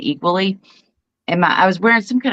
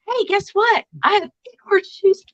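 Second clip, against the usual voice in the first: put-on high, slow, proudly excited little kid; 11 semitones higher, slower than usual. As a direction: brag to grandma like a proud child. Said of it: "and I said".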